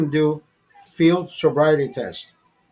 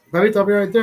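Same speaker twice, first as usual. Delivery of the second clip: louder call to the son in the car; 4 semitones higher, 4 dB louder, much faster than usual. Retold or imitated. Retelling calling across the car to his son. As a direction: call out so the boy hears from the car. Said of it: "he listens to what I s".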